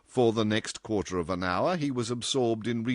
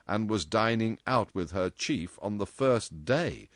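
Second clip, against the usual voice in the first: ordinary delivery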